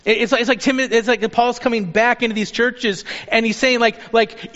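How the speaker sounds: ordinary talk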